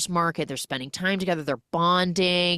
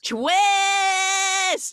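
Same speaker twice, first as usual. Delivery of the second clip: much higher, loud announcement; gleeful shout; 4 dB louder than usual. Real, not acted. Host shouts the plot twist to her co-host. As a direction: cry out the big reveal.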